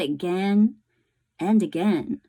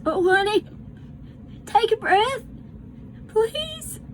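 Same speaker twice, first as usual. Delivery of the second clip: much higher, breaking desperate plea; pleading grief; 14 semitones higher than usual. Acted. Bryce begs his dying sister Sarah Ruth to breathe, breaking voice. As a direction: beg her, voice climbing and cracking.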